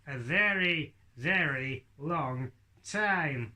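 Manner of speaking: very slow, stretched words; drawn-out slowness. Acted. Puppet act joking about tortoise lifespans, dragging it out slowly. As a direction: drag out each word like a tortoise.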